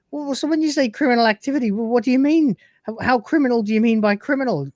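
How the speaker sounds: high, fast, panicked babble; hammed-up panic, words tumbling out; panicky. Retelling Lister panicking about the mind probe, hammed-up. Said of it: "Lister starts panicking".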